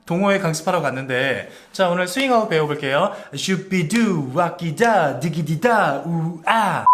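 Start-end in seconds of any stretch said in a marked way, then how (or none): 1.7-6.9 awkward scatting teacher. Retold or imitated. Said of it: "좀 민망한 부분이".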